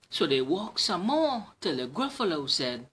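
ordinary delivery

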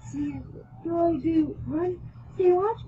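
high, questioning panic, mildly acted; scared. A narrator's reading. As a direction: ask it scared, pitch lifted. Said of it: "I said, scared".

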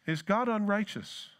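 usual speaking voice

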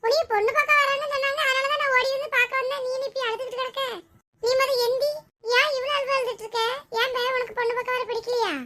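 high-pitched, fussing friend; concerned friend. Acted. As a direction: question her urgently in a high voice. Friend fusses over crying bride. (அக்கறையுள்ள தோழியின் உயர்ந்த குரல்)

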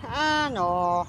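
lightly imitated catchphrase, drawn out; mimicked drawn-out catchphrase; Oh My Gosh. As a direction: stretch it out in a mild imitation. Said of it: "would say".

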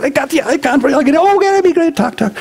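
high, bubbly retold chatter; happy reunion. Two old friends reunited, chattering high and giddy. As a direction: bounce through it, giddy.

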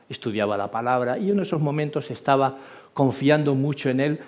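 normal talking voice

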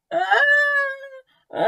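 long acted groans of pain; drawn-out moaning "aah". A narrator's reading. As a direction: groan like a hurting crocodile. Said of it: "a loud groaning sound".

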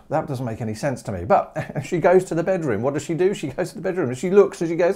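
ordinary talk

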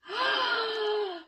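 high, drawn-in gasp of delight; real high gasp. Real, not acted. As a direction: gasp in happy disbelief. Delighted by a gift.

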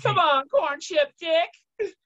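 high, excited cry-out. Real, not acted. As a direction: shriek it with glee. Host reacts to story.

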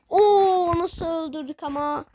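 loud high whoop of delight; excited. Real, not acted. Streamer cheering a great kill, loud excited whoop.

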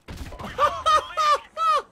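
very high, loud laughing; exasperated cackle. Real, not acted. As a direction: squeal with helpless disbelieving laughter. Laughs in frustration.

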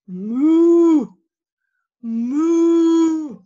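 long cow moo. Pretend. Cow in review.

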